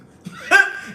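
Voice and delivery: high loud burst of laughter. Real, not acted. Host cracking up at her own impression, high and loud. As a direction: break into laughter.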